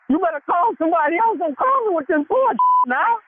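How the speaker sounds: high-pitched indignant denial; voice getting high. Real, not acted. Accused worker denying he told anybody, voice getting high. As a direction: protest with the voice climbing high. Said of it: "Why your voice getting high".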